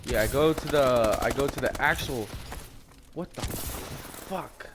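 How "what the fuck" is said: very slow, soft, voiceless disbelief; hushed disbelief. Real, not acted. Player reacting to the game mid-story, hushed disbelief. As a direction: let it out slowly under your breath.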